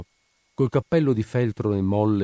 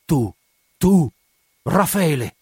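high, loud startled cry of recognition; hoarse cry; 7 semitones higher, 6 dB louder, slower than usual. A narrator's reading. The redhead recognising the young man, hoarse loud cry. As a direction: cry out his name in shock. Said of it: "Mise un grido rauco".

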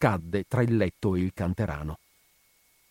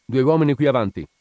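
loud, raised-pitch order; commanding; 4 semitones higher, 6 dB louder than usual. A narrator's reading. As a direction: order your men forward. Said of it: "Il brigadiere comandò". (comando secco ad alta voce)

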